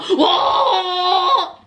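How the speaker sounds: long, loud, held "whoa"; hammed-up drawn-out holler of alarm; mock startled whoa. Mimicking a startled car passenger.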